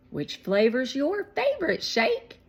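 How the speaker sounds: put-on high, loud girl asking; curious girl Claire. A narrator's reading. Claire asking the boys about the truck, high girl voice. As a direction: brighten into a little girl's question.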